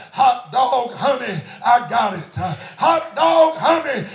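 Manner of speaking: loud exclamation of joy; mother shouts hot dog, overjoyed; joyful shout. Retold or imitated. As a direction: rejoice out loud, just saved. Wife tells husband rejoicing.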